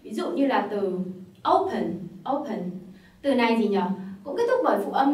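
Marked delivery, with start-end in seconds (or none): 1.5-2.7 "open" with the weight on "o", falling away on "pen"; stress on first syllable. Shown as a demonstration. Teacher repeats an English word for learners, stress on first syllable. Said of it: "stress vào cái âm tiết thứ nhất là o".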